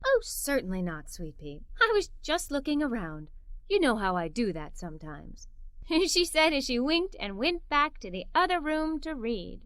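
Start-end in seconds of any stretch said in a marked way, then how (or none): 0.0-5.5 lower, softer voiced mother; a touch of mother's voice. Mother teasing her daughter, softly lowered voice. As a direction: lightly play the sly mother.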